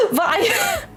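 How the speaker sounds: high giggle breaking through; cracking up mid-sentence; laughing. Real, not acted.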